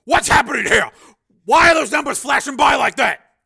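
high, loud roar; angry full-voice interrogation; furious shouting. Acted. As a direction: bellow the questions at the culprit. Major yells at scientist.

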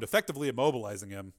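ordinary delivery

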